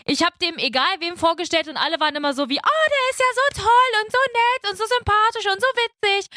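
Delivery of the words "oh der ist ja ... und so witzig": high gushing praise. Retold or imitated. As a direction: gush like an excited fan. Gushing friends quoted.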